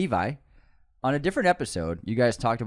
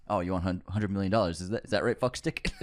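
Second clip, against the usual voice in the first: mock Les Grossman; 5 semitones lower, 6 dB quieter than usual. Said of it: "he goes".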